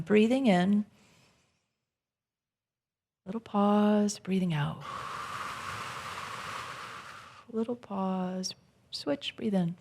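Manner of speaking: soft, fading guided breathing, ending whispered. Acted. Leads a breathing exercise.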